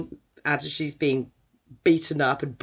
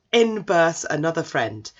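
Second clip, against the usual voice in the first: normal talking voice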